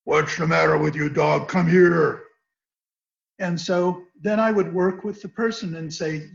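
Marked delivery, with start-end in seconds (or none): 0.0-2.2 loud scolding of a dog, imitated; mock gruff man ordering his dog; imitated looming owner. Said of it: "the man would tower over the dog and say".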